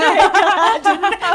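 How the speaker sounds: ordinary talk, nothing marked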